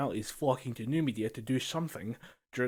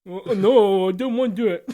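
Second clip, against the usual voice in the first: loud, high whiny refusal, laughing through it; hammed-up squeal of a listener dodging the vaccine; mock reluctant listener; 7 semitones higher, 12 dB louder than usual. Playing the reluctant listener back at the advert, loud whiny laughing refusal.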